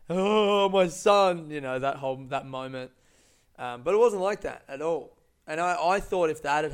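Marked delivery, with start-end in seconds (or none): 0.1-1.5 a hammed-up, louder, higher tearful dad; emotional father. [name] greeting his long-lost son, mock tearful gushing. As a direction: gush it like an overcome father.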